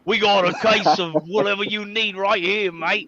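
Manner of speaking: hammed-up Aussie salesman; mock Australian accent. Salesman pitching customers in a fake Australian accent. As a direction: put on a cheeky, exaggerated Australian accent. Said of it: "gonna pop this Australian accent today".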